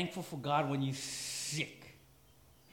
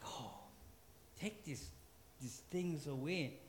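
soft mock plea; 4 semitones lower, 14 dB quieter than usual.

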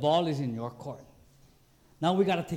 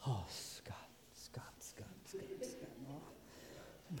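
very soft voiceless whisper; God's disappointed whisper; 5 semitones lower, 20 dB quieter, much slower than usual. Retold or imitated. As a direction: whisper the name over and over, reproachful. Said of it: "He said".

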